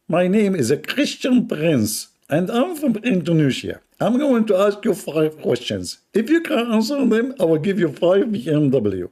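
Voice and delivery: mock the Indonesian critic's accent in a high voice; imitating Indonesian man. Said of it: "He speak like this. ... So I have to copy him".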